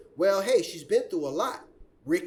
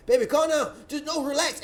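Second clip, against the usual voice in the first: higher-pitched retold pleading; urgent, raised calm-down plea; pleading partner; 6 semitones higher than usual. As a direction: imitate a man urging his partner to settle. Retold man calming his partner.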